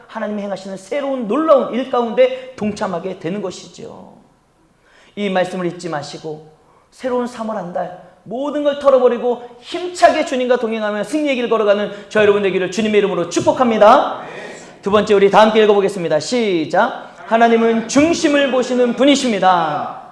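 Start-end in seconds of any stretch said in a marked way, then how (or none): none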